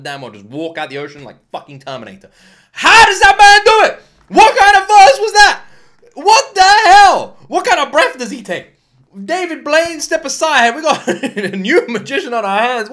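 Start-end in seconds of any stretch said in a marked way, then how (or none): 2.7-8.7 amazed shouting. Real, not acted. Shocked by breathless rap. 9.2-12.9 excited hype. Real, not acted. Hyping the rapper.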